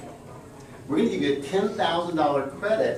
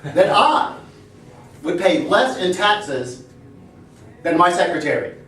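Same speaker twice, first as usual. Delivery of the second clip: presidential speech; 4 semitones higher, 4 dB louder than usual. Retold or imitated.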